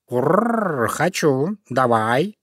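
purr, then accept keenly; eager purring cat. Acted. Cat accepts fox's tasty offer, purring.

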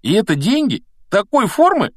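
put-on high-pitched, incredulous boy's questions; amazed questions. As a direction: marvel at the odd thing, pitch jumping up. Ron amazed by a strange coin.

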